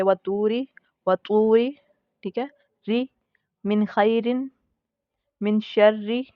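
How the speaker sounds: री in वतूरी said light and thin, empty mouth. Shown as a demonstration. Teacher demonstrating thin रॉ in recitation examples. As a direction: keep रॉ thin in वतूरी and शर्री. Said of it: "उसको भी हम बारीक पढ़ेंगे".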